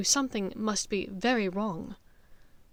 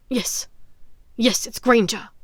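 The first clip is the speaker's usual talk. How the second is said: eager louder cry; father's eager, louder cry; 7 dB louder than usual. Acted. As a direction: cry out to claim the prize. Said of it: "cried Lucius".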